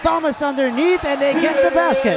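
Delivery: high, loud play-by-play shout; excited basket call. Real, not acted. Announcer calling a basket underneath, shouted excitedly. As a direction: punch it out as the ball goes in.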